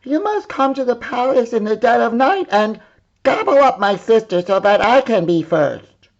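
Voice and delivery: high, loud demanding princess; bossy princess. Acted. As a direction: order the wolf about, high and pushy. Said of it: "she said".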